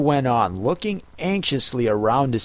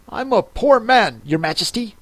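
trembling; 8 semitones higher than usual. Acted. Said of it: "in a trembling voice".

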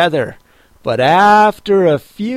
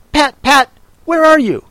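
higher, louder cross Rabbit calling; angry Rabbit; 10 semitones higher, 4 dB louder than usual. A narrator's reading. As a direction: call the servant, vexed. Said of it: "Next came an angry voice".

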